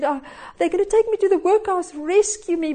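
lightly acted child's appeal; pleading little girl.

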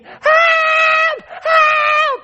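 high, loud panicked cries of help; frightened relative crying for help, high and loud; retold screams for help. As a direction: scream for help like you're stuck on the cable car. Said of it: "and she screamed".

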